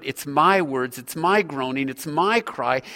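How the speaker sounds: "my" pressed a little harder each time, the rest low and even; stress on my. Shown as a demonstration. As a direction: lean on every "my" to show it is personal. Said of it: "It's all these personal pronouns".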